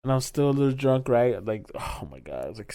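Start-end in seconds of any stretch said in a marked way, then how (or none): 1.4-2.7 groaning. Retold or imitated. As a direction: drag it out like a hungover moan. Recalling being still drunk.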